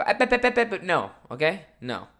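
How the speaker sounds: high, sharp "ep, ep, ep" interruptions; cutting someone off. Retold or imitated. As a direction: shut him down mid-sentence. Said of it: "And I go".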